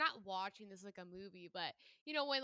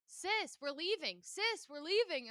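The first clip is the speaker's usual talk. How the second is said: put-on higher, slow little-boy call; imitating little boy; 7 semitones higher, slower than usual. Retelling the boy at Lake Erie, high child call. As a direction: call out like a small kid. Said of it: "this boy came up to me and goes".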